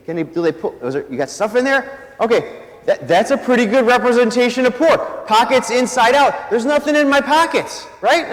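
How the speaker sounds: ordinary talk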